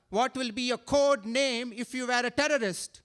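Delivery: ordinary delivery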